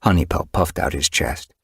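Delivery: ordinary delivery, nothing marked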